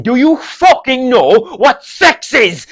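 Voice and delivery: put-on loud, high, slow Kerry-accented yell; exasperated dad. Dad forced to repeat himself to son, exasperated shout. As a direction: hammer it out as a fed-up father. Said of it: "he'll get really annoyed if he has to repeat himself".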